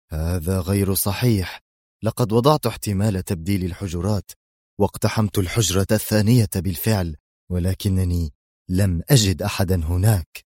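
usual speaking voice

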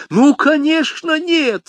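very high, slow exclamation; emphatic Bonacieux. A narrator's reading. Bonacieux insists it is not D'Artagnan, high-pitched. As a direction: squeal the denial emphatically. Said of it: "сказал Бонасье".

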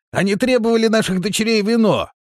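high, loud aggrieved voice; outraged protest. A narrator's reading. Trapped villager telling of the demand for daughters, loud protest. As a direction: call out the grievance.

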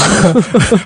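high, short laugh; amused laugh. Real, not acted. Host reacts to the coffee excuse, high amused laugh. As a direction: let out a high chuckle. Joking about already drinking coffee.

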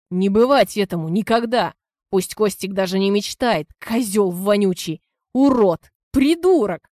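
from angry to yelling insults; working himself into a fury; building rage. Acted. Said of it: "Продолжал распаляться Антон". (нарастающая ярость до крика)

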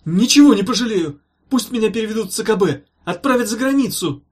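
high, loud frantic pleading; desperate eagerness. A narrator's reading. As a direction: promise anything at full urgency. Hopeful patient offers everything.

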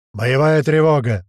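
slow, loud roar of command. Acted. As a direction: bellow the alarm to the crew. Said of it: "взревел он".

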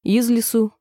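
ordinary delivery

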